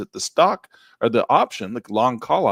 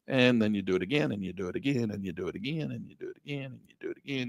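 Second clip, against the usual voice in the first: fading repetition; 7 dB quieter, faster than usual. Acted. Repeating, trailing off softer.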